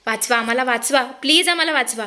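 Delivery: ordinary delivery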